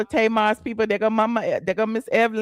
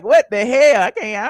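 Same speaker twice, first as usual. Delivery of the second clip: normal talking voice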